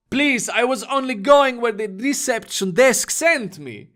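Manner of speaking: put-on high, pleading foreign-accented voice; a frightened student pleading in broken English. A narrator's reading. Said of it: "said in broken English".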